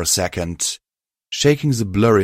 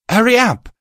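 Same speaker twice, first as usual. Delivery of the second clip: much higher, louder urgent call; 6 dB louder than usual. Acted. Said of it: "Nurse Care called".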